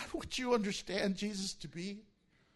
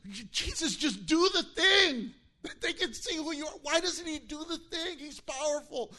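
higher, louder pleading disciple voice; 7 semitones higher, 4 dB louder than usual. Retold or imitated. Disciple begging Jesus at the cross, higher and louder. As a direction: beg Jesus to show his power. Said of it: "I wonder if disciples".

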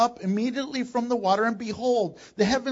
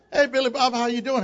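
bright put-on phone greeting, a bit louder; fake cheer; 4 semitones higher, 4 dB louder, faster than usual. A father fakes cheer answering his son's call. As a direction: greet the caller with forced cheer.

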